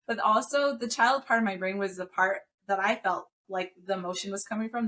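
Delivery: ordinary delivery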